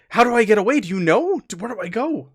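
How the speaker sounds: mildly panicked, voice lifted; flustered. Acted. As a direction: fire off the questions, a bit rattled. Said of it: "he was kinda flustered".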